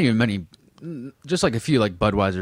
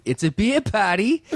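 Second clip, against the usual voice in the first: high, drawn-out mimicked catchphrase with a laugh; dad catchphrase, Massachusetts accent; 11 semitones higher than usual. Friends mocking dad's line, high exaggerated accent. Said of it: "became a thing with all my friends".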